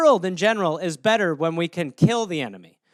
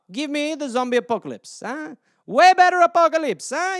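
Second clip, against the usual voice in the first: playful boast; 7 semitones higher, 4 dB louder than usual. Real, not acted.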